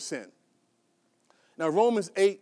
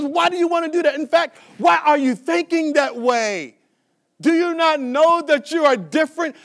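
loud rebuke; 6 semitones higher, 7 dB louder than usual. Retold or imitated. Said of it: "Paul says".

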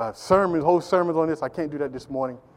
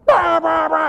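loud, high honking bah bah bah; shouted horn noise; car horn imitation; 10 semitones higher, 6 dB louder, slower than usual. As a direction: blast it like an angry car horn. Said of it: "and they blow the horn".

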